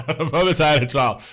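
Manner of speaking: laughing through the words; real laugh while repeating it. Real, not acted.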